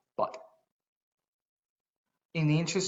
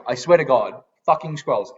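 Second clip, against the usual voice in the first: usual speaking voice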